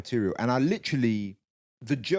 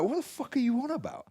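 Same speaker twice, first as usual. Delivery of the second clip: much higher, baffled retort; incredulous; 13 semitones higher than usual. Retold or imitated. Comic answering a heckler, high incredulous retort. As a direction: fire it back in disbelief. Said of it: "And I'm like".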